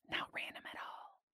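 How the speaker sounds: hushed whispered aside. Acted. Preacher leaning in to the congregation, whispering. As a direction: drop to a secret whisper.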